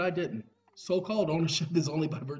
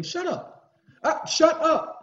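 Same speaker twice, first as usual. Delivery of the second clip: snapping; 13 semitones higher, 9 dB louder, much slower than usual. Real, not acted. Telling someone off.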